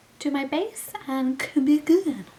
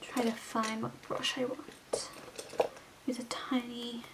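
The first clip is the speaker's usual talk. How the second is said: breathy whisper to herself; 9 dB quieter than usual. Real, not acted. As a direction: hush it under her breath.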